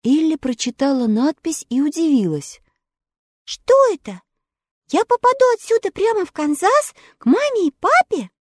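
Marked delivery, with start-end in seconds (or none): 3.4-8.3 put-on high, bright girlish wonder; a little girl asking hopefully; girl's voice. A narrator's reading. Little girl asking about home, high voice. Ellie's voice in story.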